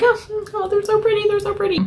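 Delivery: very high, squealing delight over pretty nails; giddy squeal. Retold or imitated. Telling husband right after the salon, high giddy squeal. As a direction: gush like a kid with a new toy.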